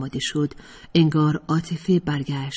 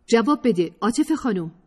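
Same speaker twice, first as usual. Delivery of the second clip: insistent demand; 6 semitones higher than usual. Acted. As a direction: press her hard for an answer. (با اصرار و تندی)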